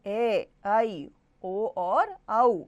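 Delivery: drawn-out ए, ऐ, ओ, औ; long vowels. Shown as a demonstration. Teacher finishing the list of long vowels, each one stretched. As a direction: hold ए, ऐ, ओ, औ for double length. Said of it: "दुगना समय".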